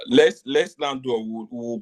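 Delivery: ordinary talk, nothing marked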